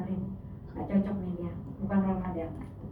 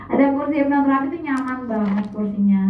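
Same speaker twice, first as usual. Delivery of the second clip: usual speaking voice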